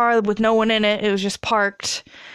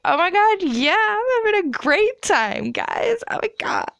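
put-on high, valley-girl squeal of a party chick; mock party girl; 12 semitones higher than usual. Mocking a giddy party girl to her friends, high and squealy.

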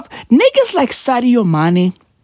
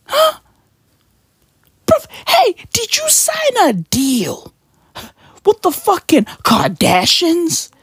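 much higher, squeaky mock voice asking the friend; mock exasperated bro; 11 semitones higher than usual. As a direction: squeak it out like an incredulous mate. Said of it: "And I be like".

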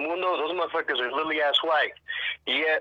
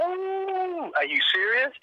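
shocked; 13 semitones higher, slower than usual. Retold or imitated. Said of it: "I was like".

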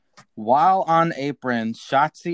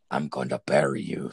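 much lower menacing voice; quoting Santos; 12 semitones lower than usual. Retold or imitated. Host quoting Santos with a low menacing voice. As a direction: growl it like a threatening wrestler. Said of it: "Santos says".

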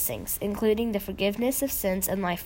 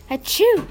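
sharp high sneeze burst; loud sneeze; 10 semitones higher, 9 dB louder than usual. A narrator's reading. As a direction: let out a big loud sneeze. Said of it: "sneezed Mom".

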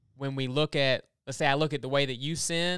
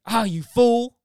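loud, high-pitched imitated outburst at a driver; hammed-up road-rage cry; mock road rage; 9 semitones higher, 8 dB louder than usual. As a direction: yell it like an exasperated driver. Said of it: "get a little bit of road rage".

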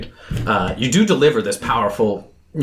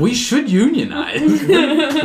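laughing; much slower than usual. Real, not acted. Repeats joke, laughing.

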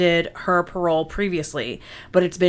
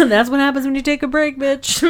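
laughing tease; 7 semitones higher than usual. Acted. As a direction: rib her through laughter. Teasing absent cohost.

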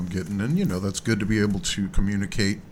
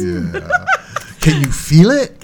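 loud, slow, laughing call to the crowd; hyped-up call; 14 semitones higher, 8 dB louder, much slower than usual. Real, not acted. Host firing up listeners before the band's song, loud and laughing. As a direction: draw it out and pump everyone up.

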